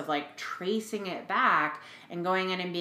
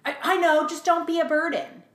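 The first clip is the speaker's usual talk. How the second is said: much higher, louder impersonation of mom; mom's reply; 10 semitones higher, 6 dB louder than usual. Retold or imitated. Mother answering her child, insistent higher voice. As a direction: repeat the warning like an insistent mother.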